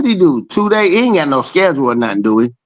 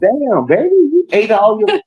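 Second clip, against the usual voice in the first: laughing tease; 11 semitones higher than usual. Real, not acted. Teasing girlfriend about food.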